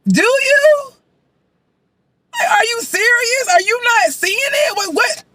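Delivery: high-pitched, stunned questioning; incredulous. Real, not acted. Viewer stunned by Jimmy's declarations of love, incredulous. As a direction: squeal it in total disbelief.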